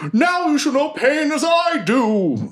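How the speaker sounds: high, slow, menacing proclamation; vengeful villain. Acted. Forsaken with a hand on the foe's face, vengeful villain voice. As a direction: declaim the threat in a high villain voice.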